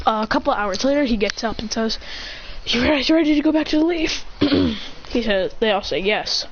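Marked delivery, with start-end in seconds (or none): 2.7-4.2 light, higher, quick question to the group; lightly acted rallying question, pitched up; eager question. A ninja asks his team if they're ready, eager question.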